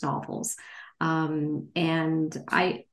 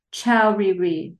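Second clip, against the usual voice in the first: slow, loud Italian-flavored greeting; lightly voiced Italian charm; Italian flair; 2 semitones higher, 7 dB louder, slower than usual. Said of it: "in his natural Italian flair".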